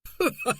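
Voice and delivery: high, airy forced laugh; hammed-up laugh to cover; falsely jolly chuckle; hearty laugh. A narrator's reading. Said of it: "Morris laughed heartily".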